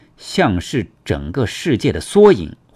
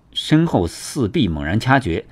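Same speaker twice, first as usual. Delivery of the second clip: plain reading voice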